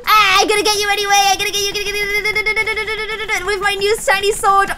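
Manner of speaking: high, fast teasing chase voice; playful threat. Pretend. Streamer chasing a spider, playful high taunt.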